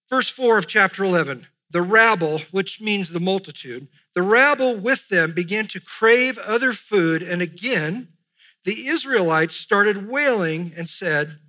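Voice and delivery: preacher reading the text out loud, deliberately; scripture reading. Acted. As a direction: proclaim the verses to the congregation. Reads scripture aloud to congregation.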